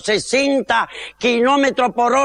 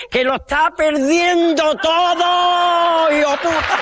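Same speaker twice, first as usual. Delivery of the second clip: character voice; 5 semitones higher, slower than usual. Acted. Character exclaims after finding ear.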